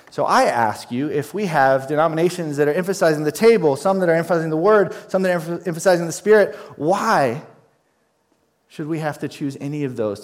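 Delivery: loud, rising preacher's challenge; fiery sermon peak; preaching at full force. Acted. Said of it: "rising preaching challenge".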